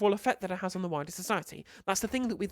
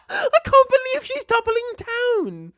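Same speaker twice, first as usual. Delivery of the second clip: high, loud, drawn-out incredulous laugh-cry; laughing disbelief; 9 dB louder, slower than usual. Real, not acted. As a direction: crack up in real disbelief, stretching the words.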